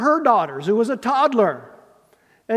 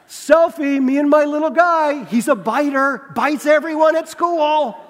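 mock caption; 8 semitones higher, 7 dB louder than usual. Imagined honest Facebook post.

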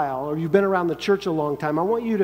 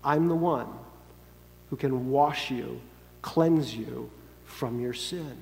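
tender Jesus; 5 semitones lower, 5 dB quieter than usual. Acted. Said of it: "the picture Jesus is creating".

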